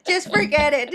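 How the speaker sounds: laughing while speaking; words through laughter. Real, not acted. Laughing at joke.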